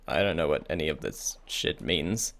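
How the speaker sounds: normal talking voice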